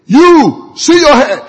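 high, loud jeering voice; mock taunt. Social media user taunting the president, loud jeer. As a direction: jeer at him like a troll.

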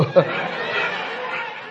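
a quick airy giggle up high; short high chuckle; high breathy laugh. Real, not acted. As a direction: laugh lightly at the joke. Laughing at his own joke.

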